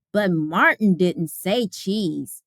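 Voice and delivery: ordinary delivery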